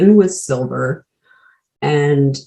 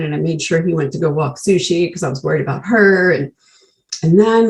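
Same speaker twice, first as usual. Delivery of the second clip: normal talking voice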